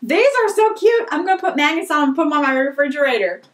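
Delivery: ordinary delivery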